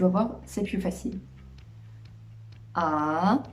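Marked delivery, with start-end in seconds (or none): 2.7-3.4 "A" dipping low, then rising; third tone dip. Shown as a demonstration. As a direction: drop "A" low and bring it back up. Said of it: "baisser la voix et monter".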